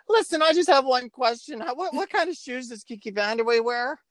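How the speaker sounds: put-on high little-boy voice; squeaky kid voice. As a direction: squeak it out like a twelve-year-old caller. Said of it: "this is exactly how I sound".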